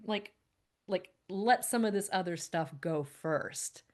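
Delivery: ordinary talk, nothing marked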